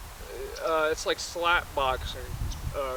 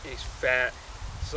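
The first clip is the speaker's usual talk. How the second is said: ordinary delivery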